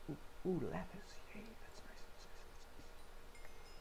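soft breathy whisper of delight. Real, not acted. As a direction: whisper it happily to yourself. Quiet happy find.

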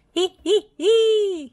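very high, loud sneaky giggle; chuckling. Acted. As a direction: cackle with mischief. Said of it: "he chuckled".

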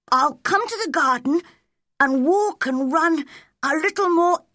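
put-on high-pitched, resolute boy; high character voice, full of purpose; Colin. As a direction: share the plan in a young boy's eager high voice.

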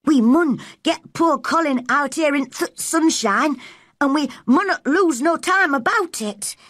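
put-on light Yorkshire accent, girl's voice; Yorkshire dialect. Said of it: "Yorkshire dialect".

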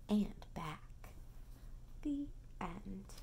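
soft smiling whisper; whispered with a smile. A narrator's reading. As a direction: breathe it tenderly. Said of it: "whispered with a smile".

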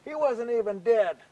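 high, loud indignant outburst; really mad. Acted. Skunk realizes he has been tricked, really mad. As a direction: protest angrily at being fooled. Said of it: "he gets really mad".

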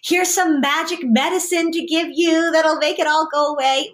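high, sing-song reassurance; hammed-up comforting mom; mock soothing mom. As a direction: coo like an overly soothing mother. Mom's reassuring voice, acted.

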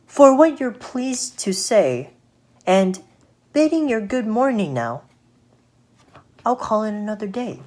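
storybook fly, high and courteous; polite little fly. Acted.

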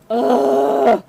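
acted groan of exasperation; exasperated groan. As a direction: let out a big frustrated groan. Exasperated at being doubted.

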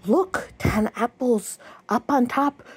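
usual speaking voice

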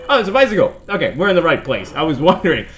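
ordinary talk, nothing marked

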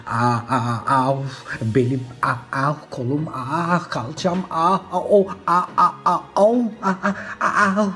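broken moans and ows between gasped words; hammed-up hurt whimpering, bit by bit; pained groaning. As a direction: groan over each aching body part. Fallen knight hurt, names sore parts.

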